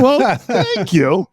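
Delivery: slow, high, drawn-out thanks; milk the thank you; mock gratitude. Thanking the teacher for the B, slow and high. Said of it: "I said".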